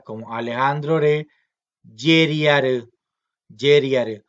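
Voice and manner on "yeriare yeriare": yeriare spoken loud and slow, word by word; teacher modelling yeriare; modelled word. Shown as a demonstration. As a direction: sound out yeriare clearly for the class. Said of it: "vean que se repite en este caso".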